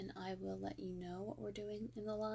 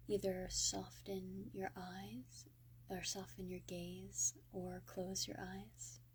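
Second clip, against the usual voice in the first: soft, hushed meditation voice with long pauses; guided meditation; 8 dB quieter than usual. Real, not acted.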